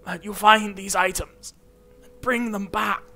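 put-on a high, wavering old master giving orders; old master's voice. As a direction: command the student like an aged wizard teacher. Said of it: "the pain of doing that voice".

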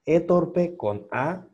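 "E torpe con A" stretched slowly, blending the vowels. Shown as a demonstration. Teacher slowly demonstrates the blended vowel. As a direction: draw out "E torpe con A" so the sounds melt together. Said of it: "están muy pegaditos".

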